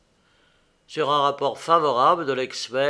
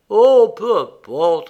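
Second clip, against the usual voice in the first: loud, high, slow protest; blushing dismissal; 7 semitones higher, 12 dB louder, slower than usual. A narrator's reading. Inventor dismisses the capital's pleasures while blushing, flustered. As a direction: brush it aside a little too strongly. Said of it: "répliqua Philippe en rougissant".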